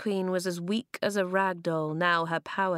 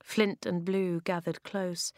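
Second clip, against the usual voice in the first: usual speaking voice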